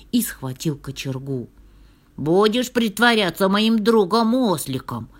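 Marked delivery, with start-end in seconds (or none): 2.2-5.1 loud, raised threatening question; high, loud storybook scolding; angry shouting. A narrator's reading. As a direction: shout it as an accusation. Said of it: "кричал он".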